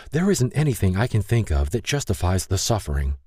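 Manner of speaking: lightly voiced, demand to know why; Lay's hurt letter. Lay writes angry letter to God.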